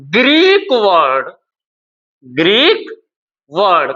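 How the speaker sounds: word-by-word dictation with long pauses; slow dictation. Real, not acted. Teacher dictating notes to students, slow dictation. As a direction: draw each word out for note-takers.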